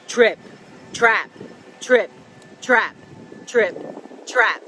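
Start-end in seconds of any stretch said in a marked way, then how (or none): none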